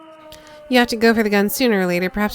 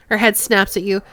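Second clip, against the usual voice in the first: usual speaking voice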